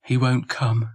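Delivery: usual speaking voice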